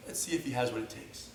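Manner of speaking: very soft, low private thought; hushed sizing-up. Acted. Existing students sizing up a new student, hushed. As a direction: think it aloud under your breath.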